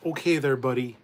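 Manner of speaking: loud, pointed jab with emphasis on "buddy"; mock-condescending buddy. Recalling being a bit of an asshole growing up, loud condescending put-down. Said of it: "with the emphasis on the buddy".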